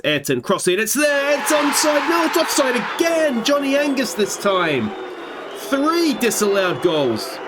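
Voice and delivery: high, shouted play-by-play; rising shout over a near goal; excited commentary. Real, not acted. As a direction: call the goal then the offside. Live goal then offside call.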